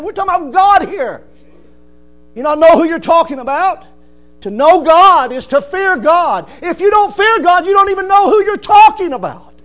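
building to a loud high challenge; fiery preaching. Acted. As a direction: rebuke the proud listener. Preaching at full force.

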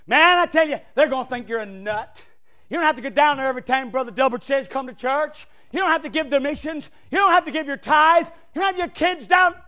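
a hammed-up devil whispering excuses to the congregation; tempting devil. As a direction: wheedle like the devil talking you out of church. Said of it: "the devil's not far behind him, saying".

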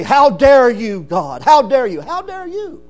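slow, loud "How dare you"; mock indignant accusation. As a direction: voice the outraged sinner. Men accusing God.

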